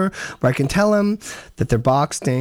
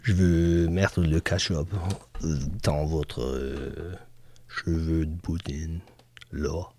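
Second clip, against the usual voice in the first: put-on French accent; 10 semitones lower than usual. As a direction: croon it like a smooth French lover, much lower. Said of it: "So you say".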